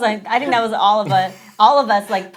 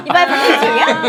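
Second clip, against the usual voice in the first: loud, higher, laughing words; real laughing plea; 6 semitones higher, 8 dB louder than usual. Real, not acted. Friend begging a free console, laughing. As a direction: ask it through loud laughter. Joking amid group laughter.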